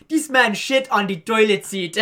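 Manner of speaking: loud, high imitated Jamaican complaint; Jamaican accent. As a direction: protest indignantly in a put-on Jamaican accent. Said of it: "I find his accent so fucking funny".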